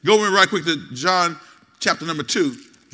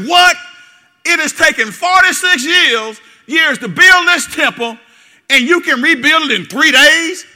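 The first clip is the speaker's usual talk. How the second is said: high, loud disbelieving outcry; incredulous shout; 10 semitones higher, 10 dB louder than usual. Retold or imitated. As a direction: shout it as if he has lost his mind. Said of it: "they exclaim ... powerful emphasis".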